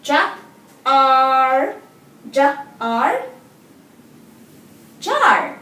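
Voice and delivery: Ja and Ar split, then blended into Jar; sounding out words. Shown as a demonstration. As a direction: sound out Ja, Ar, then join them. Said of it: "Say the sound slowly".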